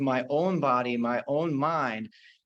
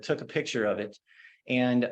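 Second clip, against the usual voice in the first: ordinary talk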